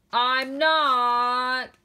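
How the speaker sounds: loud, cross "I'm not"; grumpy denial. Acted. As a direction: bark it back grumpily. Grumpy Jim denies being grumpy.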